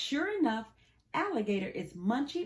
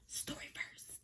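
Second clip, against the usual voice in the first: soft whispered plea; 14 dB quieter than usual. Real, not acted. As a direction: whisper the request under the covers. Child whispers asking for story.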